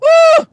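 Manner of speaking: high, loud "woo"; loud, high exclamation of disgust; disgusted whoop. Real, not acted. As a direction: let out a high cry at the stench. Disgusted outburst at the smell.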